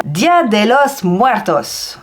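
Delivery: slow attempt at Spanish with a French accent; amateur Spanish accent. Real, not acted. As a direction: pronounce it carefully in clumsy, French-accented Spanish. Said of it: "j'ai essayé de le prononcer avec mon accent". (accent espagnol approximatif, à la française)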